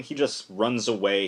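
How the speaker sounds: ordinary talk, nothing marked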